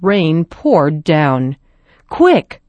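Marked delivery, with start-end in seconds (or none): 2.1-2.6 rally the family fast; calling out. Acted. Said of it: "Father called".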